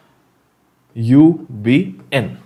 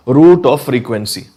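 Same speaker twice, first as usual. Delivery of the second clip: ordinary talk, nothing marked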